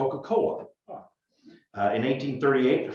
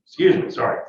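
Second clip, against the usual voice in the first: ordinary talk, nothing marked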